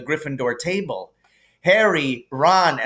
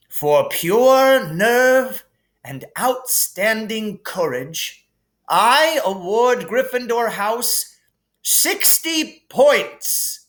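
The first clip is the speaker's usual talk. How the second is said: higher, grand headmaster voice; Dumbledore voice; 9 semitones higher, 3 dB louder, slower than usual. Acted. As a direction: hand out points with relish. Dumbledore awarding points.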